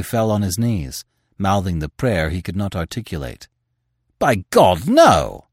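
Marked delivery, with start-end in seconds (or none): 4.2-5.5 loud, raised cry of protest. A narrator's reading. Editor springs forward to stop a killing, crying out. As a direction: cry out in horror, pushing the volume. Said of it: "cried the editor".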